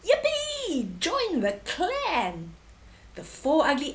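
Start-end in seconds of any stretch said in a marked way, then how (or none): 0.0-2.5 high yippee, slow welcome; gleeful shout. Acted. Three animals welcome the warthog with a whoop. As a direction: whoop with delight. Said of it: "the other three shouted".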